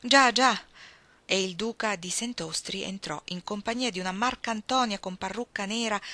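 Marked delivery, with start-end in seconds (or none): 0.0-0.6 quick, louder, higher cry of agreement; an eager countess calling out her yes; eager exclamation. A narrator's reading. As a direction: exclaim it brightly, all at once. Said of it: "assentì esclamando subito".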